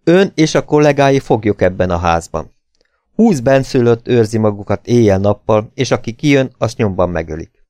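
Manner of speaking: ordinary delivery, nothing marked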